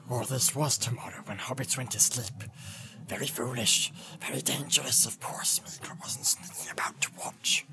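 soft hissed whining; sulky Gollum. Acted. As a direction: whine it in a sly half whisper. Gollum complains.

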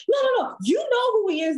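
high, pushy voice of the mom's coworker; mock insistent coworker. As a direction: insist high and sure of yourself.

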